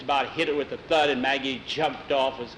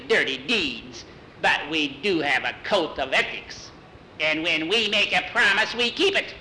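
haughty witch, indignant; 3 semitones higher than usual. Acted. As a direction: lecture the stupid boy as an offended witch. Witch lectures Jackie on honor.

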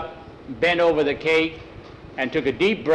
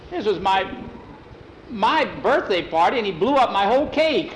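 high, halting, aggrieved complaint; upset protest; 6 semitones higher than usual. Acted. Jackie protests to Tom about his ruined party, upset protest. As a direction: complain in a raised voice, halting then building.

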